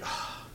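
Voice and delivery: soft, breath-only sigh; imitated sigh. Preacher voices discouraged believers to the congregation, imitated sigh. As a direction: let out a hushed, discouraged sigh.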